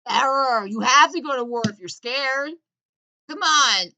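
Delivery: loud, high, hammed-up fear of terror; mock panicked shout. Comic mocking the war on terror, panicked hammed-up shout. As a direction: yell it like a scared patriot.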